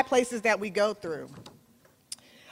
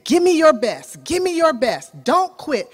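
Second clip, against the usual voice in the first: coach yelling; 5 semitones higher, 6 dB louder than usual. Retold or imitated. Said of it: "the coach is just yelling at him".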